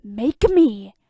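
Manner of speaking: put-on a drunk man daring his rival; hissed defiance. A narrator's reading. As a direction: spit it back as a dare. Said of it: "Bruce hisses".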